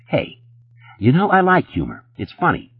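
high-pitched mock amusement; mean mockery. A narrator's reading. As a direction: sneer it, pretending to enjoy the joke. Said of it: "Jeff laughed meanly".

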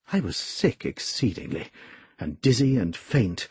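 ordinary delivery, nothing marked

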